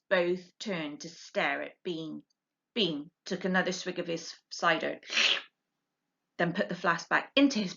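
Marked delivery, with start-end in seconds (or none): none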